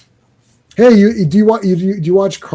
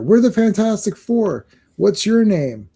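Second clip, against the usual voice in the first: higher-pitched hero greeting; heroic introduction; 6 semitones higher than usual. Acted. Fantastic Four greeting Gladiator through the translator, higher mock-heroic voice. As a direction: play a team hailing a stranger. Voicing heroes addressing the alien.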